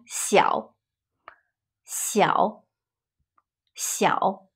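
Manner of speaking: 小 low and soft, dipping. Shown as a demonstration. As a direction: let 小 sink low and quiet. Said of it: "Low tone".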